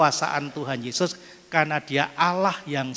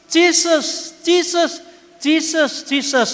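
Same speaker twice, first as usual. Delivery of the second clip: high, louder repeated calls of Jesus; mock panicked cries, much higher; old woman crying out; 11 semitones higher, 3 dB louder, much slower than usual. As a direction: imitate a frantic grandma calling on Jesus. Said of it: "Dia teriak-teriak di pesawat".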